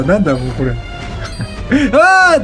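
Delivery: ordinary delivery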